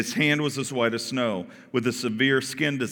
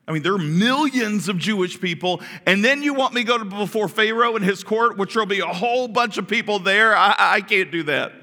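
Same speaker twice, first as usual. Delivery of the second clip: high, louder protest, rushing in; mock panic; 9 semitones higher, 5 dB louder than usual. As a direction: act out Moses balking in a panic. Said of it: "and you panicked".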